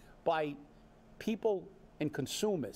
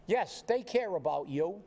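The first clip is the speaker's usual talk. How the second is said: ordinary delivery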